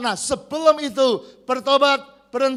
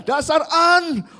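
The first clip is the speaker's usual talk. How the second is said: mock cursing; 7 semitones higher, 4 dB louder, slower than usual. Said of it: "mau maki".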